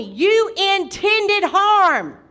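slow, loud scripture declaration; preaching. Acted. Preacher quotes scripture to the congregation, slow preaching. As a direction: draw it out and build in force.

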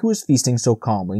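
plain reading voice

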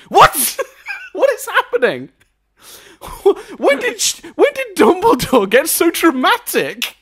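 high, loud incredulous laughing rant; can't believe what you're watching; laughing disbelief. Real, not acted. Host ranting about dramatic Dumbledore, incredulous. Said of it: "ranting in disbelief".

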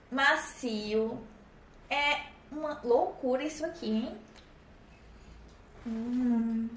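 talking while chewing pastry; muffled words between bites; mouth full, chewing. Real, not acted. Seen 3: Bites croissant near 1.3 s and keeps chewing through 6 s.

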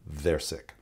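low, soft, matter-of-fact verdict; understated, certain aside; experienced paramedic. Acted. Experienced paramedic answering puzzled students, quietly sure. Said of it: "the experienced paramedic is going".

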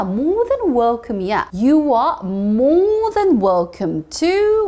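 slow teaching model; dragged-out English model; very slow drawn-out read. Shown as a demonstration. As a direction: stretch every word for learners. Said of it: "teacher stretches phrase".

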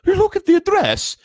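very high, loud Dracula-like quiver; Aunt Petunia quivering. A narrator's reading. Aunt showing husband the address, overdone Dracula-like quiver. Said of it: "that sounded like a Dracula thing".

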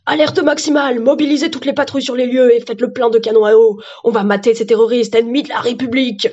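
loud police chief orders; hammed-up, forceful commander; commanding alert. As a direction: shout orders to mobilise every unit. Police chief orders full mobilisation.

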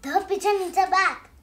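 loud, pleading pigeon voice; pushy pigeon. Acted. Pigeon begging the reader for help, loud and pleading. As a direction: beg like a grubby bird wanting help.

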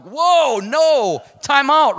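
loud, high cry of protest; drawn-out, shouted objection; alarmed protest. Retold or imitated. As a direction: call a panicked halt at full voice. Panicked reaction to God's answer.

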